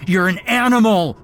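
high-pitched, loud outraged yell; furious scream at a captor; enraged cry. A narrator's reading. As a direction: hurl the accusation in a fury. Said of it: "I cried out in rage".

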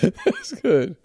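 words squeezed out through laughter; laughing. Real, not acted. Host praising the caller's joke while laughing. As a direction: still laughing as he speaks.

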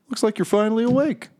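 high-pitched, pleased fiend chattering away; high casual demon host, repeating himself happily; chipper fiend. Acted. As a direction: play a fiend delighted with his own work. Game master plays fiend Bell.

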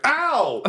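sudden high yelp; struck mid-sentence. Acted. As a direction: yelp as if hit on the head. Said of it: "as a flute hits her".

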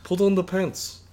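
high, loud mock Russian accent; Russian wife mock accent. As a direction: playfully imitate a Russian wife. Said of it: "Oh, she was Russian?".

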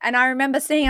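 ordinary talk, nothing marked

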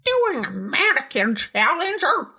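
put-on high-pitched, slow, pissed-off old man; high grumpy old man. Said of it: "I went with the higher voice".

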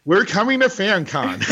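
says it through a laugh, pitch up; laughing announcement. Real, not acted. Agreeing to the host's invitation, laughing. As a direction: laugh out the news.

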